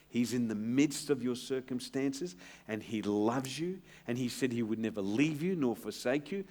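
ordinary talk

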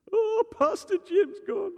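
high, loud hammed-up wail; mock wailing. Paid mourner wailing over Pastor Jim, hammed-up high cry. Mocking professional wailers.

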